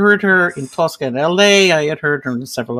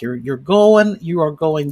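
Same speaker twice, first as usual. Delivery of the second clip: ordinary delivery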